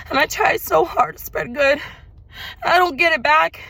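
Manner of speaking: high, loud, tearful cry to the sky; retold tearful plea. Reliving a tearful plea to God, high and loud. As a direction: wail it out like you did then. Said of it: "I broke down and I just started crying".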